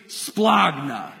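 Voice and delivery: Splagna voiced deep from the belly; from the gut. Shown as a demonstration. Pastor and congregation chanting the word together, from the gut. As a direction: let Splagna rumble up from the gut. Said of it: "You have to say it with the gut".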